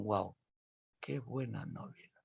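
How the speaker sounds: softly amazed, almost breathless; a hushed wow of admiration; hushed awe. Acted. Friends reading all night, hushed wonder.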